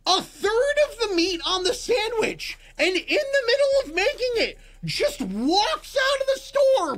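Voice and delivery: high-pitched, outraged disbelief; incredulous. Real, not acted. Storyteller recounting worker abandoning the sandwich, incredulous. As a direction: build the absurdity in a high, amazed voice.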